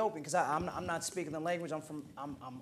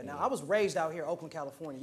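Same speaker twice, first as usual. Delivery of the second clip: normal talking voice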